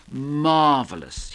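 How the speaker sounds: long, slow drawl on Marvelous. Acted. Fritz drawling to Chris about Sally, slow and lazy. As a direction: stretch the word out lazily. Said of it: "he drawled".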